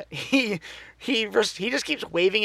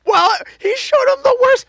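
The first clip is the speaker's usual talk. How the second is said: much higher, louder wailing cry; outraged shout; 9 dB louder than usual. Real, not acted. Podcaster shrieks at Luke showing Grogu the worst memory. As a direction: shriek it in mock despair.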